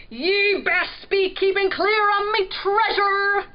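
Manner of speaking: high, loud bellowed warning; pirate bellow. A narrator's reading. Pirate warning the prince off his treasure, bellowing. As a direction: bellow it like a pirate guarding loot. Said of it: "pirate bellowed".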